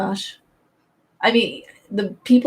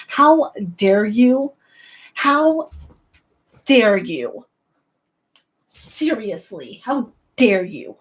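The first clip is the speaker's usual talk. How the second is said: slow, drawn-out outrage with heavy pauses; mock indignation; much slower than usual. Zelda fan scolding someone, slow and theatrically offended. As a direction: space out every word in mock offence.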